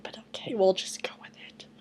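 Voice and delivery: resigned whispered aside. Real, not acted. Vlogger giving up on the story, whispered. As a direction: let it go under your breath.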